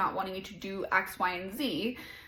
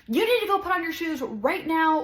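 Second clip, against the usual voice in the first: put-on higher, louder scolding of a child; mock yelling at toddler; 7 semitones higher, 5 dB louder than usual. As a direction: bark the order at a little kid. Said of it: "yelling at her".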